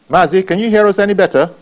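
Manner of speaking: to someone hard of hearing. Real, not acted. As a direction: raise the voice so the caller hears. Host repeats, caller still silent.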